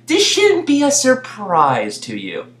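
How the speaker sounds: ordinary talk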